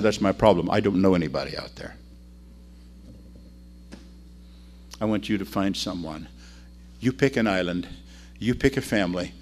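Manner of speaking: low, subdued reply; quiet admission. Acted. Missionary asking sailor to pick a family, quiet and low. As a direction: answer softly and simply, leaving pauses.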